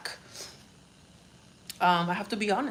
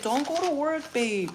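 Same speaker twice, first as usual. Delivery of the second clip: higher mock boyfriend plea; lightly voiced boyfriend warning her; boyfriend quoted; 7 semitones higher than usual. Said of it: "My boyfriend said".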